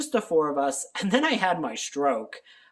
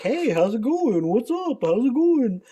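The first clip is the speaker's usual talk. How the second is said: higher, friendly cat voice; cat's friendly greeting; 8 semitones higher, 4 dB louder than usual. Pretend. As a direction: voice the cat saying hi cheerfully. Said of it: "always is like".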